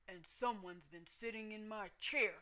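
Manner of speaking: lightly voiced bear father, cross; Papa Bear yelling. A narrator's reading. Papa Bear finds his porridge eaten, mock gruff yell. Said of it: "yelled Papa Bear".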